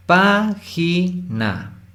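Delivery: "Página" very slowly, split into pá-gi-na; high first syllable, "Página" broken syllable by syllable; separado en sílabas. Shown as a demonstration. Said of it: "De estas tres sílabas". ("Página" muy lento, sílaba por sílaba)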